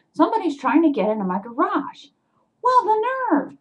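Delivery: high, loud outraged retelling; worked-up, incredulous storytelling; indignant. Retold or imitated. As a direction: relive the outrage, voice jumping up. Reliving the break-in indignantly.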